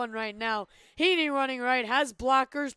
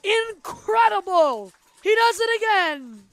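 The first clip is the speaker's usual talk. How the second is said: excited; 6 semitones higher, 7 dB louder than usual. Real, not acted. After the touchdown.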